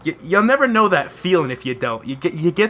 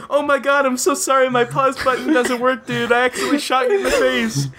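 mock-sorry, pitched much higher, last line fast; fake apology; 8 semitones higher than usual. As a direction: sell a fake innocent apology to the kid you just shot. Fake apology while shooting kid.

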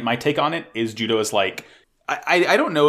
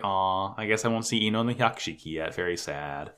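mock disappointment; 4 semitones lower, 6 dB quieter than usual. Retold disappointment at missing out.

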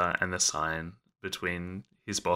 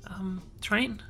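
high, hesitant nervous office worker; flustered Jacques; 11 semitones higher than usual. Acted. Jacques asks the train attendant for stationery, high and flustered. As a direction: stammer it out, up high and unsure.

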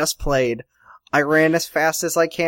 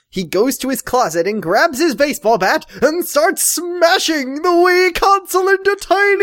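building excitement; 12 semitones higher, 3 dB louder than usual. Acted. Narration rising into frenzy.

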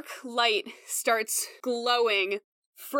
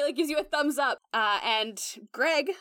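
usual speaking voice

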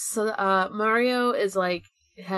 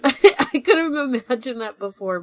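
giggling through the words; real amused laughter; laughing; 4 semitones higher, 6 dB louder than usual. Real, not acted. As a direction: laugh it out in disbelief. Laughing at the effort involved.

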